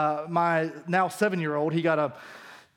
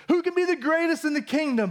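mock disciples; 9 semitones higher than usual. Echoing the disciples' question mockingly.

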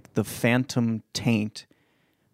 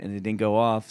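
ordinary talk

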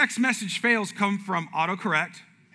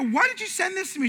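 high-pitched, indignant demand; mock-outraged husband; accusing question; 13 semitones higher than usual. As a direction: confront her, acting out offence. Acts out confronting his wife.

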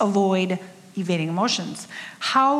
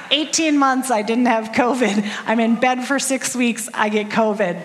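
louder, punchy comic disbelief; exasperated outburst; 3 semitones higher, 6 dB louder than usual. Real, not acted. Telling the congregation about catching covid, loud and exasperated. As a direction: vent the irony of it all.

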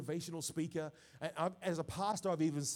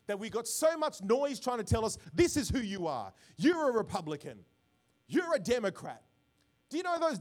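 loud, raised preacher's delivery; forceful preaching; 3 semitones higher, 7 dB louder than usual. Real, not acted. Pastor rejecting political labels before his congregation, forceful preaching.

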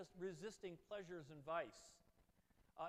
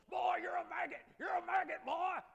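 screaming Southern drill sergeant, much higher and louder; 10 semitones higher, 13 dB louder than usual. Retold or imitated. Southern drill sergeant screams at the recruit, loud and high. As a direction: bark it like a Southern drill instructor in boot camp. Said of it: "a guy with a southern accent goes".

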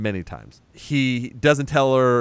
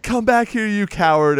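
much higher, loud shout after him; mock angry call; 9 semitones higher, 3 dB louder than usual. Stein yelling after the fleeing Champ, mock angry. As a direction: yell after a runaway. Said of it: "So he's like".

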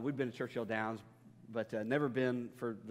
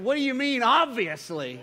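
much higher, louder hammed-up protest; mock outraged shout; 10 semitones higher, 11 dB louder than usual. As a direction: yell it in fake outrage at the wife. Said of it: "I said, I said".

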